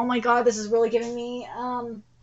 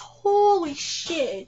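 stunned exclamation; 5 semitones higher, much slower than usual. Real, not acted. Streamer stunned by anime scene.

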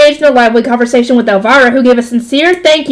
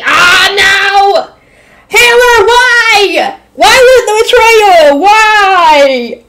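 mock anguished wail; 6 semitones higher, much slower than usual. Real, not acted. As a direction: wail at a fictional betrayal. Reader dismayed at Hannelore.

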